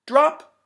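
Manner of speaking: high loud bright drop; loud high droplet call; drop sound. Acted. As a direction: pop the word like a falling drop. Drop landing sound effect.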